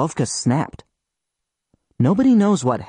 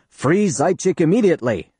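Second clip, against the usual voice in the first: a raised, higher voice giving the order; commanding order; 5 semitones higher than usual. Retold or imitated. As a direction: bark it out like a decree from above. Imagined order from Stalin.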